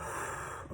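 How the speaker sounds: re-enacted long, quiet exhale; resigned sigh. Retelling her answer to Spirit's request, resigned sigh. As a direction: act out a soft sigh of giving in.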